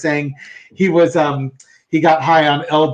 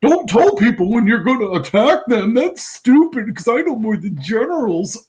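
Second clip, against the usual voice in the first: mocking Trump; 7 semitones higher than usual. Co-host mocking Trump's boast, higher mock voice. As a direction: sneer it like a boastful blowhard. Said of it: "this is from the same guy that says".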